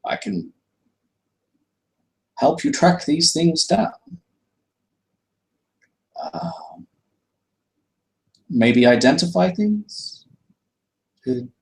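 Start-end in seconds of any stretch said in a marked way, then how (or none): 0.0-10.3 put-on high, polite old shopkeeper; welcoming the party back to the shop, high and courteous. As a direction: greet the customer in a lifted, genteel voice. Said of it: "Would, uh".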